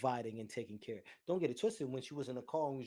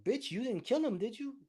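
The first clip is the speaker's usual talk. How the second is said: incredulous rant; 10 semitones higher, 3 dB louder than usual. Real, not acted. Ranting at fake mourners.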